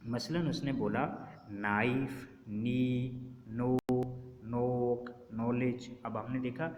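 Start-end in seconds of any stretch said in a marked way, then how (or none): 1.5-6.0 "नाइफ, नी, नो, नोक, नॉलेज" each starting straight on N; silent K. Shown as a demonstration. Teacher listing example words one by one, silent K. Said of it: "हमने के को pronounce नहीं किया".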